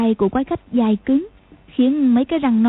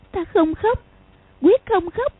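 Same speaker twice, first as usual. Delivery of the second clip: put-on inner child voice; 8 semitones higher than usual. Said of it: "nghĩ thầm".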